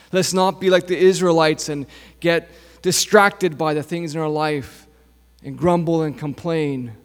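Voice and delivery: normal talking voice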